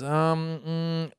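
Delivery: soft, drawn-out stalling; hemming and hawing. Retold or imitated. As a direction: imitate a kid stalling for a word. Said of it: "he's hemming and hawing".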